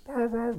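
high, repeated droning "wah"; imitated trainer whir. Mechanic describing all the trainers going, high imitated whir. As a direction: drone like bike trainers spinning. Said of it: "fifty dudes just".

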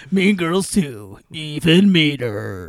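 slow, drawn-out movie trailer voice; mock trailer announcer. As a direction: announce the sequel with hammed-up weight. Spoof trailer for a sequel.

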